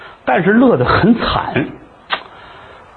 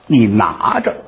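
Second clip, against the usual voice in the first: plain reading voice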